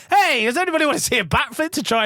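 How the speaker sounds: high-pitched mock announcement to a crowd; hammed-up calling out. Stood on a table addressing mates, mock calling out. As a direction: imitate calling the room to attention.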